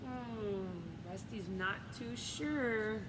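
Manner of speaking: usual speaking voice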